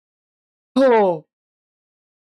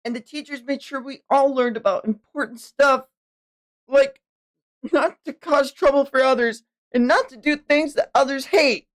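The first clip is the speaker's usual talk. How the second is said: put-on higher, childlike character read; earnest young child; 11 semitones higher than usual. Child telling an elder about school and library, high and earnest.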